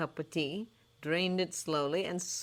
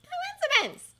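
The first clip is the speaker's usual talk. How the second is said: airy; 4 dB louder, slower than usual. A narrator's reading. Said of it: "said Hermione airily".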